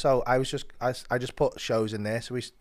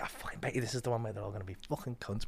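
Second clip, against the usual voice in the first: quick, much softer grumbled prediction; muttered cynical aside; 10 dB quieter, faster than usual. Retold or imitated. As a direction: mutter it fast under your breath, expecting the worst. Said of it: "you're like".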